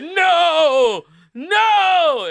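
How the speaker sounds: high, loud frantic screams of no; shrieking no. Pretend. Girl on the plane shrieking at her seatmate, frantic high yells. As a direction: shriek it in blind panic. Girl keeps refusing in terror.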